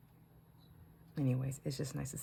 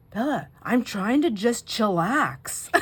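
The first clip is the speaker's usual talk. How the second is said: put-on slow, higher, louder kid voice; imitating a chill little boy; 6 semitones higher, 9 dB louder, much slower than usual. Grandma quoting her grandson asking her to let him chillax. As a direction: drawl it out like an unbothered seven-year-old. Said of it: "He goes".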